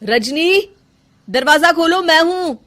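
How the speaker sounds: high, loud call through a door. Acted. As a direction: call to your wife to open up. Husband calls through closed door.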